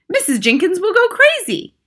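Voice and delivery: very high, loud panic; frantic yell. A narrator's reading. Father fearing his wife's reaction, high frantic yell. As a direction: yell it, pitch shooting up. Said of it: "yelled Mister Jenkins".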